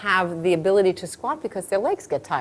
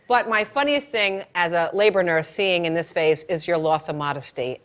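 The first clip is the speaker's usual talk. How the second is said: usual speaking voice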